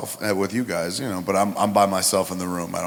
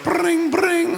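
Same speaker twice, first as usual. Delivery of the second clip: high sing-song bring bring; phone ring imitation. Interrupted by the phone, a high mock ring. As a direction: chirp it like a ringing phone.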